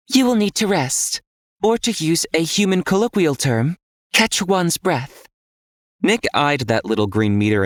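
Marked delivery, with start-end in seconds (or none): 0.1-5.3 higher, detached inner AI voice; an all-knowing guide speaking inside his head; calm system voice. A narrator's reading. System voice answers Nick.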